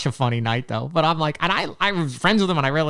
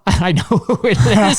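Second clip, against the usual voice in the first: laughing through the words; nervous laugh while speaking; laughing nervously; 6 semitones higher, 5 dB louder, slower than usual. Real, not acted. Caught out, knowing the answer.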